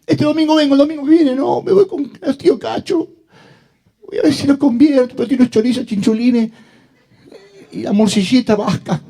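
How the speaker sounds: vocecita aguda y exagerada de excusas; cristiano con excusas. Acted. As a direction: parodia a un creyente que se escaquea, muy agudo. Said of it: "Ahí está el cristiano". (excusas en tono de falsete burlón)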